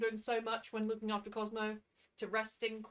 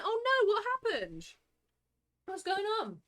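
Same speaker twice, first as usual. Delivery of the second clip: surprised; 10 semitones higher, 3 dB louder than usual. Real, not acted. Reacting to water outage news.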